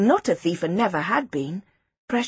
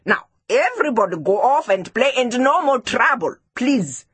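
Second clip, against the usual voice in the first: raised teacher's voice; firm, higher-pitched classroom order; firm dismissal; 6 semitones higher, 4 dB louder than usual. Acted. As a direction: send the children off briskly. Teacher dismissing quarrelling pupils.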